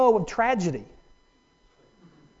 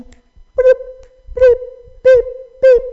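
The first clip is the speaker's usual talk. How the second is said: high, loud imitated phone beep; mimicking a phone's alert tone; 8 dB louder, slower than usual. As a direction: beep like an incoming text. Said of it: "rapid fire succession".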